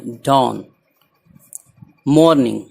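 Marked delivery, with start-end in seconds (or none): 0.1-0.7 डॉन with an open aw vowel; correct "dawn" vowel. Shown as a demonstration. Teacher correcting a misreading, डॉन with open aw. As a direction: say डॉन with aw, neither oo nor ow. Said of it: "इसको डून नहीं पढ़ना है".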